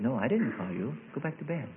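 softly voiced Eli; hushed acted reply, fading away; quiet dismissal. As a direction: send the boy off softly. Woken Eli dismisses young Samuel.

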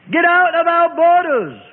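high, drawn-out angry shout; crowd's shouted demand, pitched high. A narrator's reading. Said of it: "they all shouted".

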